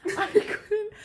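high, loud laughing gasp; can't stop laughing; laughing hard. Real, not acted. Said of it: "I was laughing so hard".

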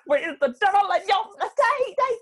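high, loud mock singer wail. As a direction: belt it way up like a parody. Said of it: "The, like".

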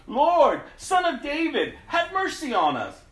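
high, loud cry for mercy; shouting. Acted. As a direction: cry out desperately to be heard. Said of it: "they began shouting".

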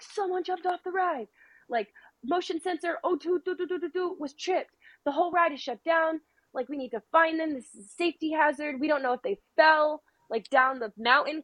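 mock shouting. Retelling ride staff hunting for who jumped off, mock shouting. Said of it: "they're all, like, shouting".

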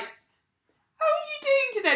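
mock-sympathetic, high and slow; concerned friend. Friend checking on a tearful woman, mock concern.

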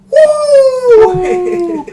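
long, high, loud "woo"; crowd-style whoop of welcome; cheering whoop. Real, not acted. Host cheers first-time guest Matt, loud whoop.

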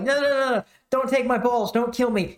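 high-pitched mock begging; mock pleading. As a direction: play a bishop begging for his life. Acts out bishop begging for his life.

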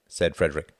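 ordinary delivery, nothing marked

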